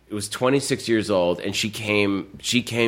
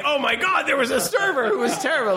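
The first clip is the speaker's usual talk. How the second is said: much higher, gushing fake-shocked voice; breathless scandalized partygoer; gossiping guests. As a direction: dish about the awful server. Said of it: "everyone at the party was like".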